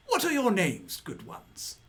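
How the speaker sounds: high, grand noble question; pompous walrus lord. Acted. Walrus asking visitors' names, high and grand. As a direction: ask with lordly delight.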